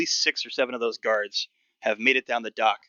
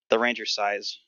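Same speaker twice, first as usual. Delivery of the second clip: normal talking voice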